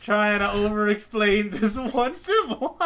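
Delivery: chuckling mock scolding; laughing tease. Real, not acted. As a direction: tease your cohost through a laugh. Teasing cohost, laughing.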